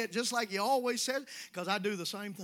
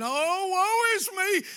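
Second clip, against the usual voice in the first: mock poor-mouth whine; 9 semitones higher, 7 dB louder, much slower than usual. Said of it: "the mully grubs and the poor mouth".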